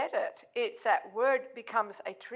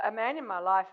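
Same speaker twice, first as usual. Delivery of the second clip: ordinary talk, nothing marked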